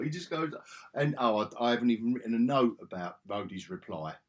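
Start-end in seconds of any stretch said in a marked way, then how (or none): none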